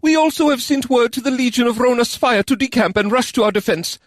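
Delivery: general overcompensating with volume. A narrator's reading. Kisei voiced in story.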